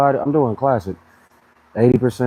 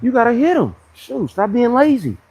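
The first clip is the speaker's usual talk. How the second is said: mock scolding; 11 semitones higher than usual. Scolding lazy people, higher and louder.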